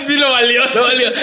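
high, laughing through the words; real amused laughter in the voice. Real, not acted. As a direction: crack up while trying to talk. Cracking up at the spitting revelation.

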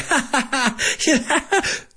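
high, hammed-up laughing ha ha ha; mock laugh. Shown as a demonstration. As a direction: fake a big goofy laugh. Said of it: "not supposed to be like".

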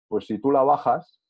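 usual speaking voice

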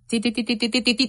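rapid repeated "ti" taps; imitated phone typing. Mother searching on her phone all night, imitated typing noise. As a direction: mimic frantic tapping with quick syllables. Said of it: "Investigar en el celular".

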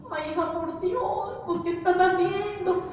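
high, slow pleading of a fretting mother; worried mother. Retold or imitated. Mother begging her daughter to drop Amway, slow and high.

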